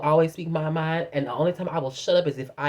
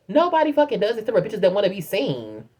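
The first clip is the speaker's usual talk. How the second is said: ordinary talk, nothing marked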